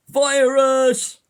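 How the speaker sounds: very loud, high yell from a passing car; mock jeering shout. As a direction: imitate someone yelling abuse at strangers. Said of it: "people go by in a car".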